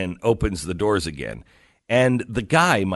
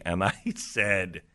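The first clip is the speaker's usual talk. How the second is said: ordinary talk, nothing marked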